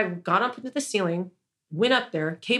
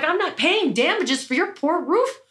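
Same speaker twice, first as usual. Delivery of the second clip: indignant refusal; 6 semitones higher than usual. Retold or imitated. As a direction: draw it out, furious at the unfairness. Refusing landlord's damages demand.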